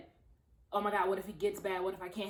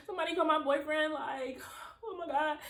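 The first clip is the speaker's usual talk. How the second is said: laughing panic; 8 semitones higher than usual. Retold or imitated.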